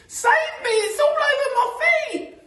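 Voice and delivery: loud, very high panicked falsetto; Missus Twit screaming. Acted. Missus Twit with it on her feet, screaming in panic. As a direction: shriek to be rescued. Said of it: "screamed Missus Twit".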